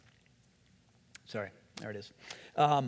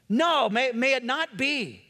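pleading denial; 8 semitones higher, 4 dB louder than usual. Acted. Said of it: "that's what he's doing here".

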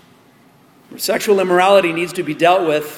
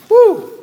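whoo exclamation; 7 dB louder than usual. Real, not acted. Exclaims after the reading.

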